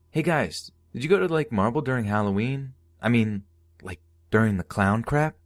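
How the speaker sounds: a lightly voiced nervous redhead. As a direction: rush it out, eager and unsure. Max asks them, voiced.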